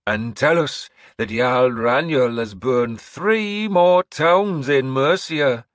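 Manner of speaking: slow, high, loud proclamation; old seeress announcing. Acted. Seeress announcing to the hall, high and slow. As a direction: proclaim like an eerie prophetess.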